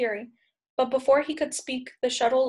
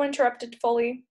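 usual speaking voice

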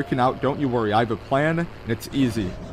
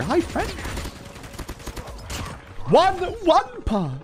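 high, drawn-out squeaky voice; squeaky greeting; 3 dB quieter, slower than usual. Pretend. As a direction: greet the enemy in a tiny high voice. Greeting enemy in funny voice.

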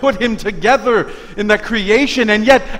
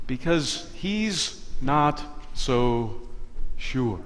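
softer, slower hesitant voice; doubtful trailing off; 6 semitones lower, 10 dB quieter, slower than usual. Acted. Preacher voicing the doubting prophet, soft and slow. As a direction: let it fade out unsure.

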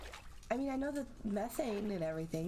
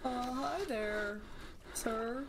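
nervous greeting; much slower than usual. Real, not acted. Greeting the sleeping man nervously.

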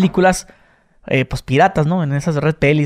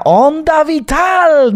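high, very drawn-out anime cry; Spain dub attack cry; 10 semitones higher, 3 dB louder, much slower than usual. Retold or imitated. Showing a friend the Spanish dub, high drawn-out cry. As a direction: stretch the attack name out, high. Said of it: "Decía".

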